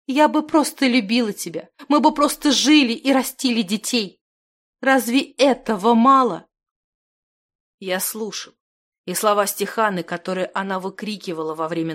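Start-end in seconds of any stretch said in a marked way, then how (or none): 0.1-6.5 put-on raised, pleading girl in despair; desperate, tearful. A narrator's reading. Girl pleads for a simple life with children, desperate. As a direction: plead high and urgently, close to sobbing. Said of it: "сотрясаясь от рыданий, спросила его девушка".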